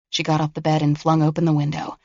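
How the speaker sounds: ordinary delivery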